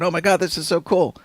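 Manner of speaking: high, rushed excited gush; quick, high-pitched mock amazement; freaking-out dad. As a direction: imitate a thrilled dad geeking out. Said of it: "His dad was freaking out".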